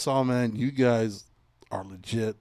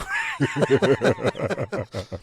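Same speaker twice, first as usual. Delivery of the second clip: a high laugh at himself; laughing at his own fear of falling back; high cackling laugh; 3 dB louder than usual. Real, not acted.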